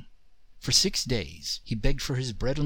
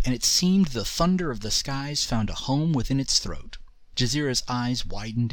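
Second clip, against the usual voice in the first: ordinary delivery, nothing marked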